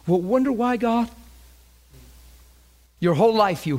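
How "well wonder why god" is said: higher-pitched mock questioning; mimicked complaint, pitched up; questioning God. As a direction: imitate people plaintively wondering why.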